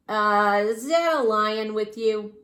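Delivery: loud, slow suspicious cabbie; doubtful cab driver; gruff taxi driver. Acted.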